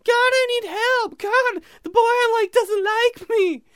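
very high, slow, put-on whine; whining. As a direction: wail to God like a lovesick whiner. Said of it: "people whining".

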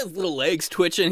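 normal talking voice

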